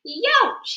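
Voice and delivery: high, loud pained yelp; dog yelping after an electric zap. Acted. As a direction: yelp like a zapped little dog. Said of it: "yelped Yuri".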